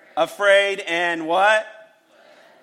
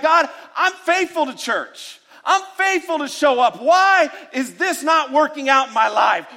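high, whiny protest to God; raised voice, half-shouted grievance; mock churchgoer complaint; 8 semitones higher, 3 dB louder than usual. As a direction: whine like an entitled churchgoer.

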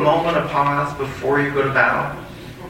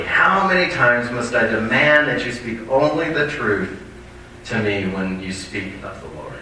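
ordinary talk

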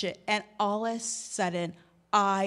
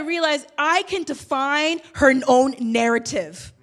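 usual speaking voice

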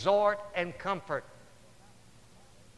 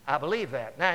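usual speaking voice

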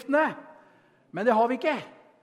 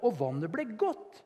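ordinary talk

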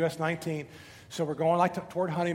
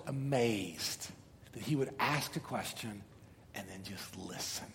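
softer, awed aside; hushed admiration; 8 semitones lower, 11 dB quieter than usual. Real, not acted. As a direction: drop to a soft, wondering voice. Admiring a listener.

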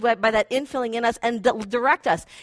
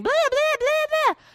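much higher, shrill mock blah blah; high mocking chatter of a shrieking mind; mock screaming emotion. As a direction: play your emotions as a screaming nag. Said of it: "Emotion starts screaming".

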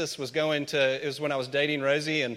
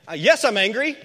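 mock angry reply; 6 semitones higher, 7 dB louder than usual. Imagined reply to God.

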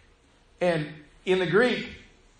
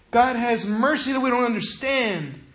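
ordinary talk

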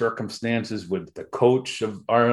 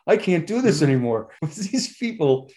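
laughing despair; 7 semitones higher, 4 dB louder than usual. Retold or imitated. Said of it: "Tim Burns, like, going".

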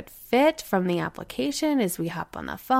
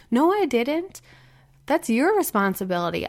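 firm rebuttal; 4 semitones higher than usual. Retold or imitated. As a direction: shut the claim down, pitched up. Mother answering her child's complaint.

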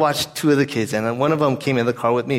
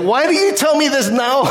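high-pitched mock exasperated wail; 11 semitones higher, 4 dB louder than usual. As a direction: comically protest at a child's bad timing. Retold exasperation at child in car.

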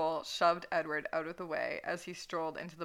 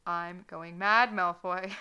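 slow and louder, begging; pleading; 9 dB louder, slower than usual. Acted. Edward begs Draco in the bar, slow and loud. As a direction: beg Malfoy, drawn out and urgent. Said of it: "looked at him pleadingly".

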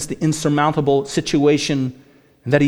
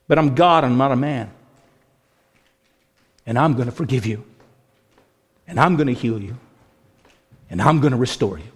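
tender divine promise; 6 semitones lower than usual. Acted. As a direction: speak as God gently promising mercy. Preacher voices God's mercy.

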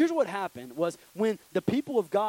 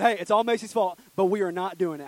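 loud retold announcement; 2 semitones higher, 7 dB louder than usual. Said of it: "I'm just kidding".

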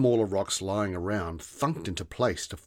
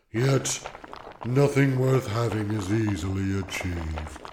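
slow, loud, weary stone creature; gravelly voice; 3 dB louder than usual. A narrator's reading. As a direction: drag it out, bored rock. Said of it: "gravelly grumble".

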